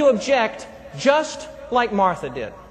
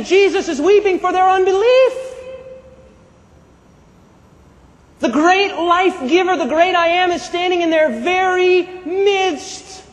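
raised voice, key phrases drawn out slowly; impassioned preaching; 5 semitones higher, 3 dB louder, slower than usual. Real, not acted. Preacher on the great I am standing unseen, impassioned. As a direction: preach it with mounting conviction.

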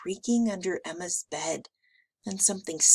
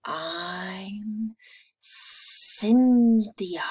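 drawn-out rasp swelling louder; rasping monster; 3 semitones higher, 5 dB louder, much slower than usual. A narrator's reading. Second monster introducing herself, drawn-out rasp. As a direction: rasp it out slowly like a slithery monster. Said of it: "The second monster rasped".

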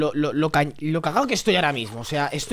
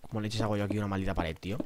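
normal talking voice

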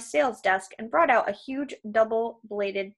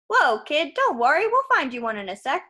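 loud, raised salesman patter; pushy giant salesman, reassuring; 5 semitones higher, 5 dB louder than usual. Acted. Krusty to a young customer, breezy salesman reassurance. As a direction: pitch it like a hearty salesman.

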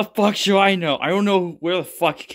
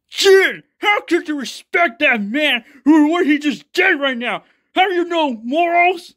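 higher, louder scolding voice; lightly voiced indignant character scolding; indignant other character; 9 semitones higher, 4 dB louder than usual. A hint of a scolding character confronting Jin.

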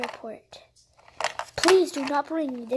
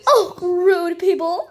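crying out; 5 semitones higher, 6 dB louder, slower than usual. Pretend. Insulted by rude taxi driver.

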